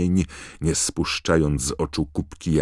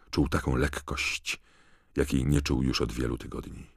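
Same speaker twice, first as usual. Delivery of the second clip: ordinary delivery, nothing marked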